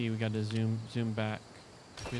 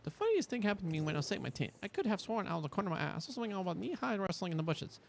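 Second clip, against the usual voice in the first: quick, higher read-aloud voice; 7 semitones higher, faster than usual. Acted. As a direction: read the journal entry briskly. Reads quest text aloud.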